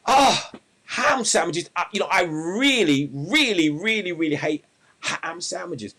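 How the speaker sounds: full-blown hammed-up wail of loathing; very loud, high, drawn-out outburst; shouted complaint. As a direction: bellow it in theatrical despair. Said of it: "he shouts out".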